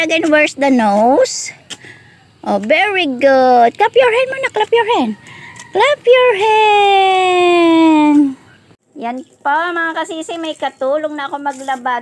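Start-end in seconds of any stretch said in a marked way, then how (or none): 3.8-5.1 high prompting. Real, not acted. Prompting baby to clap. 5.7-8.2 slow loud sing-song call; drawn-out cheer. Real, not acted. Grandmother cheering the baby to clap, slow and loud.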